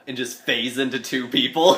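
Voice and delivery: ordinary talk, nothing marked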